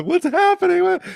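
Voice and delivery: very high, loud mock panicked plea; begging in panic. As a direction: cry it out at the ambulance window. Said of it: "begging us".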